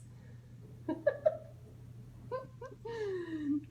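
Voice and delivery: giggling at the spotted hippo; delighted laugh. Real, not acted. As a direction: let a real giggle out.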